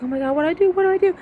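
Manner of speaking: high, loud panicked squeal. Retold or imitated. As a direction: flap like a helpless counselor. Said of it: "she's like".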